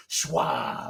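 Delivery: hammed-up Boston accent; bad Boston accent. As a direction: drag it out low in bad Boston. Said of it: "saying things in a bad Boston accent".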